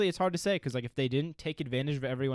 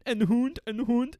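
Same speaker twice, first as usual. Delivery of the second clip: high-pitched mock Swedish; mock Swedish accent; 9 semitones higher than usual. Teasing the co-host over a dog word, mock Swedish accent. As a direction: sing it out in a joke Swedish accent. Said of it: "from Sweden".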